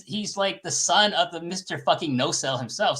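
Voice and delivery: ordinary talk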